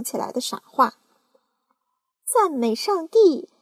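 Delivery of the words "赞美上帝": slow, high and loud praise; aunt praising God for the peach; excited cry. Acted. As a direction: draw out the praise joyfully. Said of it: "喊道".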